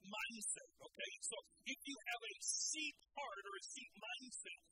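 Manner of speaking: loud, driving sermon delivery; full-force preaching. Acted. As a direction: preach it hard to the crowd. Preaching to congregation.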